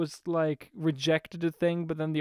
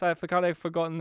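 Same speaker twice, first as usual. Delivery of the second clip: ordinary talk, nothing marked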